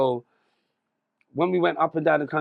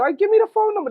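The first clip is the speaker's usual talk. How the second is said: high, eager call for the number; pitched way up with excitement; excited; 9 dB louder, faster than usual. Retold or imitated. Excited demand on phone call.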